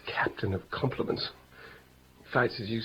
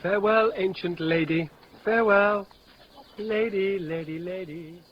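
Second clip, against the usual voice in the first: high, loud sing-song mockery fading out; jeering at the old nurse as she goes; mocking farewell; 7 semitones higher, 4 dB louder than usual. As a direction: tease her with a sing-song goodbye.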